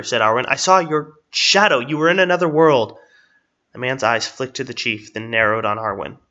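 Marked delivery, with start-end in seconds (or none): 0.5-3.0 loud, emphatic and higher-pitched; heated accusation. Acted. Woman confronting agent over what she witnessed, heated accusation. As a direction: press the charge home forcefully.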